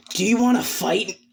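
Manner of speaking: high, combative demand; aggressive challenge. Acted. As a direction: pick a fight with the rival. Rival challenges another to fight.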